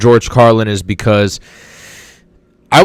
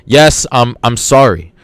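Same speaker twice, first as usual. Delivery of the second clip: ordinary talk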